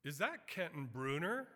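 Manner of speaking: calling out surprised. Retold or imitated. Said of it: "we heard a voice saying".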